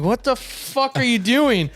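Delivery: high, slow incredulous question; baffled dad. Retold or imitated. Dad catching his kid crying over a game, imitated bafflement. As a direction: mimic a dad stretching out his disbelief. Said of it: "My dad looks, and he's like".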